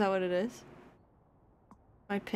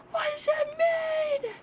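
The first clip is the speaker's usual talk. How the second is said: anguished wail; slower than usual. Real, not acted. Exasperated at a strange word.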